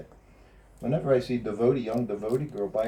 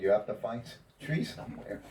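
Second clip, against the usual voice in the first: ordinary delivery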